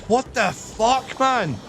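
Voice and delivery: slow, high-pitched mock shock; incredulous retold reaction; acted disbelief. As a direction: re-enact being stunned, pitch lifted. Acting out his shocked reaction.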